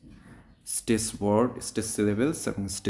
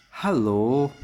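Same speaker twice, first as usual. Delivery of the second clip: "Hello" louder, swinging up at the end; rising intonation; 3 semitones higher, 6 dB louder, slower than usual. Shown as a demonstration. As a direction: greet with "hello", voice going up. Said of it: "কখন আমাদের voice up হবে". ("Hello" গলা উপরে তুলে)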